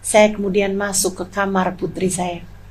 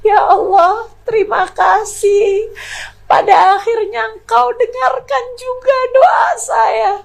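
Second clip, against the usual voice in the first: daughter's prayer; 12 semitones higher than usual. Retold or imitated. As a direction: cry out thanks like the relieved girl. Daughter's grateful prayer voiced. (anak berseru penuh syukur kepada Allah)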